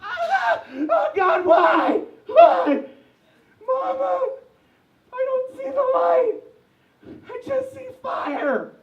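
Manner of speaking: very high shrieking wail, crying out; piercing high anguished cries, slow at the end; Timmy impersonation. Acted. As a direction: sob and scream for mama in agony. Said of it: "this is my impersonation of Timmy".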